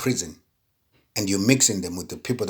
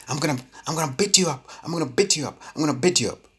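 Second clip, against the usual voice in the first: put-on high, fast, scolding threat to the kids; threatening father; 8 semitones higher, faster than usual. Father threatening his misbehaving children, high and fast. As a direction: bark it like a fed-up dad threatening a beating. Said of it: "You come as a father".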